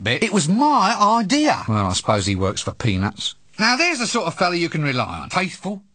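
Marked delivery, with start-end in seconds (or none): none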